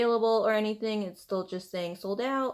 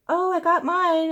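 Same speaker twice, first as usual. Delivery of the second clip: much higher and louder, breezy boast; chirpy friend; 10 semitones higher, 7 dB louder than usual. Retold or imitated. Instagram friend casually bragging she got hers, high and bright. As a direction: toss it off like it was easy. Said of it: "one of my friends on Instagram was like".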